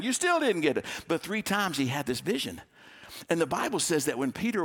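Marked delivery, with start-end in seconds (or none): 0.0-0.9 high, teasing exasperation; exasperated God. Acted. God repeats vision to Peter.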